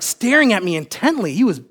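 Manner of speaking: ordinary talk, nothing marked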